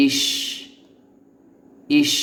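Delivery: ইস with a long hissed ending; model pronunciation. Shown as a demonstration. Teacher models the syllable for learners, model pronunciation. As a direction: hold the final s of ইস. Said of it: "এভাবে".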